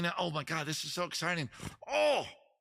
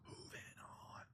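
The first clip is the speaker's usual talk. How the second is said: breathy stage whisper; hushed, unvoiced moving on; 22 dB quieter than usual. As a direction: say it again as a secret aside. Host repeats it whispered.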